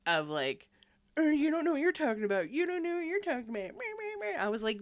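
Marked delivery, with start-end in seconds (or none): 1.2-3.5 high, fast sneering mimicry; mock the know-it-all hunter; whiny mock argument. Mocking the bickering Hunter guy. 3.5-4.4 mocking meh. Mocking bickering.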